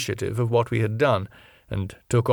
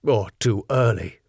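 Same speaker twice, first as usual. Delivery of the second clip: sternly; 3 semitones higher than usual. Acted. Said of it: "he said sternly".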